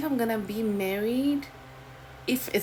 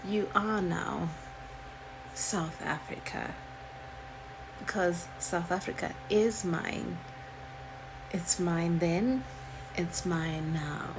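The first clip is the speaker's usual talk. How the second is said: slow, deliberate, dropping low; low, drawn-out possessive coloniser; slow smug coloniser; 2 semitones lower, 6 dB quieter, slower than usual. Retold or imitated. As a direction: lay claim calmly and slowly. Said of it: "British is like".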